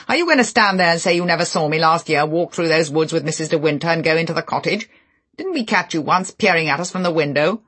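bully him with the accusation; angry tirade. Acted.

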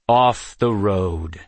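slow, loud, firm order; commanding. Acted. Amulon steps forward shield first, commanding. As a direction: weigh each word as a stern command.

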